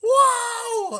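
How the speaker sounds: sudden loud, very high whoa; startled yell. Retold or imitated. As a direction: jolt into shock at full volume.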